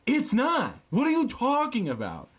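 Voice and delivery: high, incredulous push back; high-pitched exasperated retort; exasperated rebuttal. Retold or imitated. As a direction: pitch flies up in disbelief.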